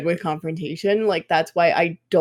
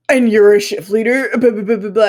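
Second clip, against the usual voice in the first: louder, higher mocking rant; mock irate customer; 4 semitones higher, 6 dB louder than usual. Customer griping at the shift leader, mocking rant. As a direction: imitate a customer's blustering complaint. Mimics angry complaining customer.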